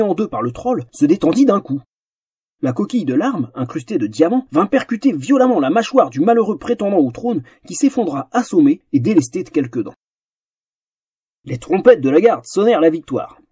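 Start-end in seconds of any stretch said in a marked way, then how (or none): none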